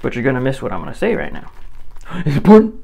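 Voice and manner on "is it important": sharp gasp, then a much higher, louder question; playing an eager viewer who gasps and asks; mock-excited gasp and question; gasp of surprise. Mock listener gasping in surprise.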